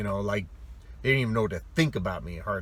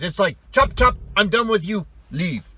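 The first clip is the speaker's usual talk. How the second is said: loud, high, chopping each word; mock curt dismissal; 8 semitones higher, 6 dB louder than usual. Russian friend mimicking a rude phone goodbye, loud and curt. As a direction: bark it like a rude brush-off.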